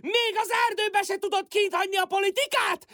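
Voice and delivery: ordinary talk, nothing marked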